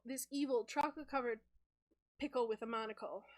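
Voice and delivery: ordinary talk, nothing marked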